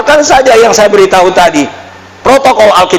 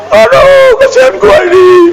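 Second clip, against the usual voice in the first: mock whining complainer; 13 semitones higher than usual. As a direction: ham up a crybaby moaning about his lot. Mocking a whiny complainer.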